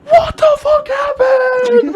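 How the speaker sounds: shrieked, very high and loud, slowly; drawn-out panicked shriek; screaming mom. Retold or imitated. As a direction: mom shrieking in shock at her hurt kid. Mom sees her hurt son.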